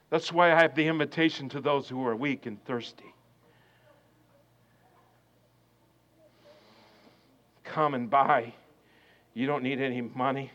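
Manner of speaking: tender hushed invitation; hushed close. Real, not acted. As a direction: draw them in with a near-whisper. Sermon closing, hushed.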